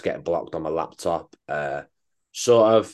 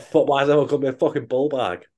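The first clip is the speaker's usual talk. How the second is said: higher-pitched mock indignant confusion; mock bewilderment; 6 semitones higher than usual. Imagining himself getting bull bag messages, mock bewildered. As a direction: act baffled by the messages, voice lifted. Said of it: "I'll be sat there like".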